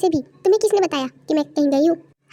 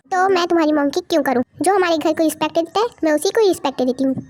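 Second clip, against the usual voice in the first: normal talking voice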